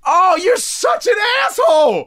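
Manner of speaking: high, slow outraged yell; outraged shout. Real, not acted. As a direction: shout it out in disbelief. Outraged at the insult.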